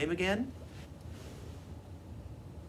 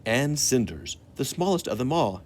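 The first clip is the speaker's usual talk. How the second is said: louder, lively read-aloud story voice; storytelling for children; 10 dB louder than usual. A narrator's reading. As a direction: tell the train story to the young listeners.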